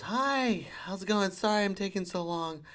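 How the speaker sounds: high chirpy greeting; bubbly customer-service lady; mock-cheerful high employee voice; rink lady. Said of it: "she goes".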